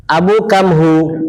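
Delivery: slow Yemeni market "Abu kam hu"; teacher imitating Yemeni market Arabic. Shown as a demonstration. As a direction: ask the price the Yemeni way. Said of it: "seperti yang kita dengar di Yaman".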